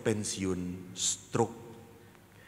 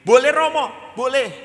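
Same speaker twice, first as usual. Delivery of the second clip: put-on high, loud, excited kid; very eager child; 14 dB louder than usual. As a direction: shout yes like a keen pupil. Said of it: "semangat sekali".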